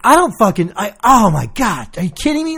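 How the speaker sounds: loud, high exasperated outburst; retold agitation. Patient retelling his outburst at check-in nurse, agitated. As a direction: re-enact losing patience, voice jumping up. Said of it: "like, visibly, like, agitated".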